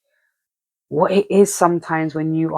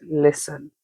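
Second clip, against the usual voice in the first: usual speaking voice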